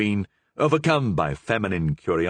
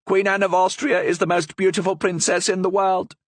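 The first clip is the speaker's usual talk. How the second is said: high, louder little bird voice; small parrot voice; 11 semitones higher, 4 dB louder than usual. Acted. Parrot complimenting the Queen, high small bird voice. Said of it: "a small voice came from under the cloth".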